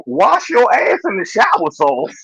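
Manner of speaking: slow "wash your ass in the shower sauce" for Worcestershire; joke mispronunciation. Acted. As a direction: spell out the mangled name slowly. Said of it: "one of my influencers that I follow called".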